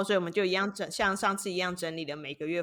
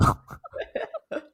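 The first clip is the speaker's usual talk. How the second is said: sheepish unvoiced laugh; guilty laugh. Real, not acted. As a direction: laugh like you got caught. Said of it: "心虚的笑声".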